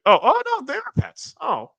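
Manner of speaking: high-pitched startled "oh no"; surprised dismay. Real, not acted. Streamer learning the viewer's frogs are pets, surprised dismay. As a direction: pitch leaps up in sudden realization.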